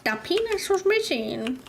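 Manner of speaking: high, far-off echo of her line; mock echo. Real, not acted. Echoing a friend's discovery in a squeaky mock voice. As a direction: parrot it back in a squeaky voice. Repeating partner's line higher.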